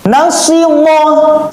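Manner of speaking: high, drawn-out chanting of the syllables; Quran recitation. Shown as a demonstration. Teacher recites for pupils to copy, high and drawn out. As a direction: chant it like a tajwid teacher showing the sound. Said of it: "perhatikan kalau Ustadz baca".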